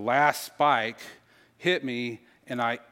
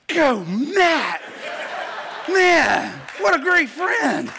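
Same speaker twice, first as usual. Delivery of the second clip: high, loud, thrilled shout with a laugh; excited cheer; 9 dB louder, much slower than usual. Retold or imitated. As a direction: cheer him on like a fan at a game. Cheering friend at hospital door.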